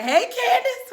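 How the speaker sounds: very high, loud mom greeting; singsong greeting. Retold or imitated. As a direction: call out in an exaggerated falsetto. Acted mom's falsely sweet greeting.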